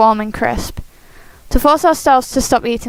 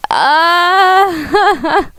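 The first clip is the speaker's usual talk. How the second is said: pained cry; 7 semitones higher, 3 dB louder than usual. Acted. Character cries out in pain.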